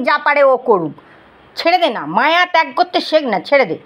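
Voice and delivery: normal talking voice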